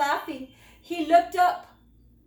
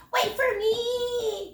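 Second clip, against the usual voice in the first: much higher, slower plea; calling out; 10 semitones higher, 4 dB louder, slower than usual. A narrator's reading. Little cub calling after his brothers, high and slow. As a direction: call after them, high and drawn out. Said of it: "called Squeak".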